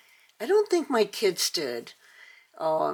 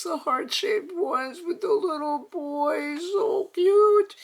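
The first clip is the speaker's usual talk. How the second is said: excited gushing; 10 semitones higher than usual. Real, not acted. Showing viewers the doll boxes, gushing higher and squealier. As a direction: gush like it's the cutest thing you've seen.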